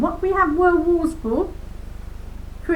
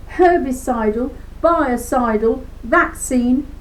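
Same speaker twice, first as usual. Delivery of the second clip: normal talking voice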